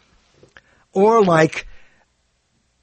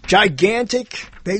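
ordinary talk, nothing marked

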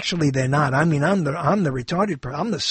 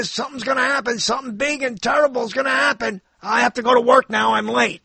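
mock doomsayer; 5 semitones higher, faster than usual. Mocking doomsayers going to work.

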